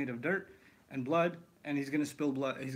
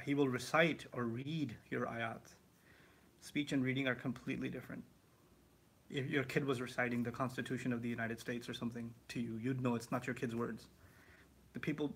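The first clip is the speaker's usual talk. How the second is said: ordinary delivery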